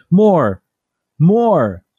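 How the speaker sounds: put-on insistent little kid chant building up; dad voicing his son's pushy demand; demanding toddler.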